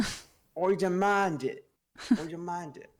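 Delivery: mock-pompous British accent, very slow; mock British demand. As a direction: drag out a posh British demand. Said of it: "you owe me a bloody British accent".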